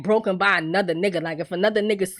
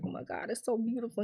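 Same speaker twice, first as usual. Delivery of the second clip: softer, hushed gasp of delight; hushed awe; 14 dB quieter, faster than usual. Retold or imitated. Fiancée admiring the ring, hushed and softer. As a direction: breathe it out in awe. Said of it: "And she's like".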